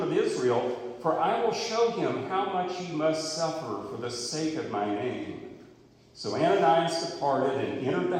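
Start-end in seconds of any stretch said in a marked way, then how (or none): none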